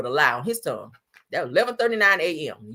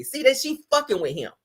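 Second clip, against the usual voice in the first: ordinary talk, nothing marked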